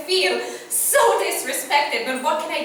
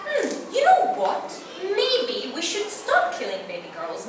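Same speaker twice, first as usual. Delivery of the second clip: musing; 6 semitones higher than usual. Acted. Voices someone having an idea.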